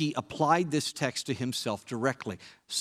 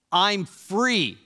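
louder and higher, triumphant; strong, joyful voice; 7 semitones higher, 7 dB louder than usual. A narrator's reading. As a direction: proclaim it like a freed prisoner. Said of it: "his voice grew strong".

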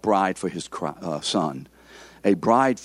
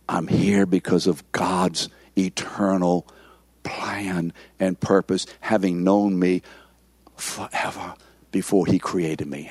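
hushed remembrance; 5 semitones lower than usual. Acted. Believer reminding himself of God's plan, hushed and low. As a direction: sink almost to a whisper on "plan" and "forever".

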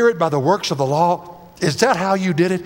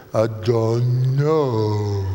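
very slow, low, drugged slur; slurred speech; 6 semitones lower, much slower than usual. Retold or imitated. Husband imitates wife on a sleeping pill, slurred. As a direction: drag it out as if heavily sedated. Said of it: "she would slur her speech".

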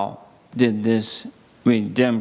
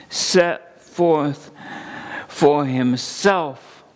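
impassioned preaching; 6 semitones higher than usual. Acted. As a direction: lift the question up to the congregation and hammer "set forth". Preaching climax on the cross.

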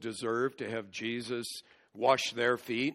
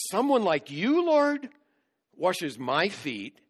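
Peter's shock; 7 semitones higher, 6 dB louder than usual. Retold or imitated. Peter protesting to Jesus kneeling before him, astonished. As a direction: voice leaps much higher and louder on Lord, then slows. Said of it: "it shows Peter's shock".

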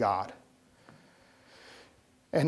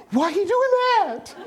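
frightened squeak; 3 dB louder than usual. Retold or imitated. Scared passenger quoted on takeoff.

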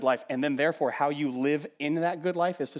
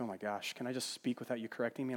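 mock annoyed listener; 4 semitones lower, 12 dB quieter than usual. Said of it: "you're like".

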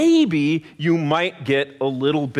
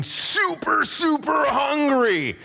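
emphatic super hungry; 8 semitones higher, slower than usual. Shown as a demonstration. Preacher stresses to the children how hungry, emphatic shout. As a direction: lean hard on each super.

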